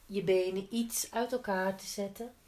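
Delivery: ordinary talk, nothing marked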